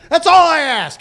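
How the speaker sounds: exasperated insistence. Real, not acted. Host demanding the candidate be consistent, exasperated. Fed-up rant, repeated with force.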